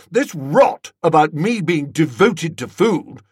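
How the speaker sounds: hot, indignant complaint; heated protest. A narrator's reading. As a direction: fume about the accusation. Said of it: "said Tuppy warmly".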